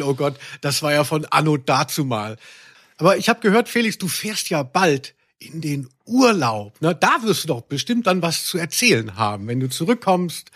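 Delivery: ordinary talk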